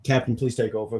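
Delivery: ordinary talk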